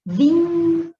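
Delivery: "vínculo" with VÍN held long, high and loud; lingering stressed syllable. Shown as a demonstration. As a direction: call out "vínculo", hanging on the VÍN. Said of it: "vai demorar mais aqui na antepenúltima".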